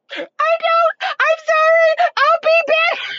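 piercingly high, loud mock crying; hammed-up child's tearful wail; imitating wailing child. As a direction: squeal a desperate little-kid apology. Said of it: "He was like".

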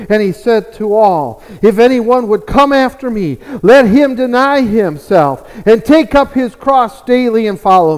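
loud, emphatic sermon delivery; projected pulpit exhortation; full-force preaching. Real, not acted. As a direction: preach it with force, driving each phrase home.

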